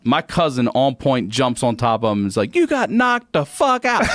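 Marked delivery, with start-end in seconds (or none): 2.5-4.0 high, hammed-up taunt; high-pitched gloating movie impression; movie catchphrase quote. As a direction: crow it like a film punchline. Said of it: "Friday had just come out".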